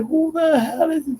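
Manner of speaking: high, put-on anime character voice; imitating a character. Anime fighter confronting the surrounded hero, high mock voice. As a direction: squeak it out high like a cartoon tough guy. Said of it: "Dude is like".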